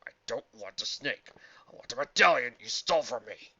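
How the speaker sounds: growling. A narrator's reading. Archaeologist demanding his stolen medallion, growling. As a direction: build to an angry growl. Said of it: "Indiana growled".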